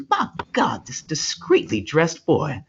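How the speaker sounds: high, slow, flustered Southern drawl; hammed-up Southern preacher. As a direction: exclaim like a scandalized Southern preacher. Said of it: "who is a Southern preacher".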